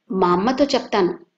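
ordinary delivery, nothing marked

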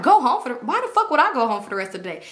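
high, quick-fire outraged comeback; heated retort, acted out; retold indignant protest. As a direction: protest it in disbelief. Retold heated argument with him.

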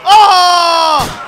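long, loud, high "oh"; loud yell. Real, not acted. Gamer reacting to a mishap in play, loud yell. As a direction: yell a drawn-out cry of dismay.

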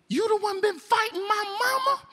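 high, strained inmate voice; accusing inmate. Retold or imitated. Inmate to new arrival about his mama, high accusing voice. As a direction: accuse him in a high put-on voice.

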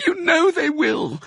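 very high, tearful insistence; sobbing. A narrator's reading. Wife contradicting her husband, sobbing. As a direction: wail it through sobs. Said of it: "sobbed Missus Fox".

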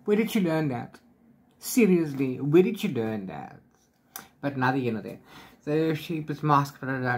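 ordinary talk, nothing marked

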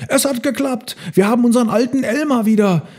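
high elephant cheering; jubilant. A narrator's reading. Herd has its old Elmar back, high jubilation. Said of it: "jubelten die Elefanten".

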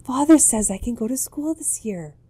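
high, loud and eager; child telling mother about school, excited; excited news. Acted. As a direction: blurt out the good news. Child bursting with good news.

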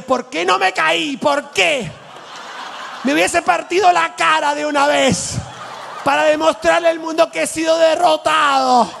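high, tragic lament after a stumble; over-the-top tragic complaint; melodramatic Argentine. Retold or imitated. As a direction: wail as if defeated by the world. Dramatic Argentine after tripping.

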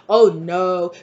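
loud, dramatic mock dismay; acted alarmed warning. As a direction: warn loudly in pretend horror. Voices karmic's alarmed warning.

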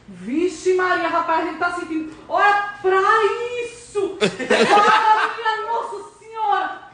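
slow thrilled cries of alarm; on a roller coaster. Pretend. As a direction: shout it like the ride drops. Riding the game roller coaster.

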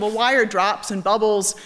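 usual speaking voice

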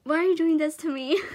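overwhelmed, near tears. Real, not acted. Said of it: "I'm gonna cry".

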